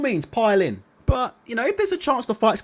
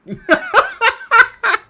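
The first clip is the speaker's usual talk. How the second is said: big high burst of laughter, louder; 14 semitones higher, 8 dB louder than usual. Real, not acted. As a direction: laugh out loud at them. Mocking the whingers.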